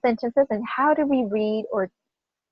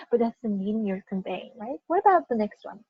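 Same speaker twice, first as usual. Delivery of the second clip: usual speaking voice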